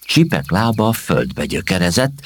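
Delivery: plain reading voice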